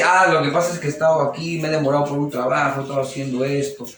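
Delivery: sorry, calm and humble; gentle apology. Acted. Innocent husband gently explaining his delay, apologetic.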